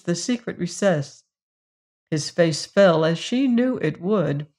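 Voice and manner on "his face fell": ordinary delivery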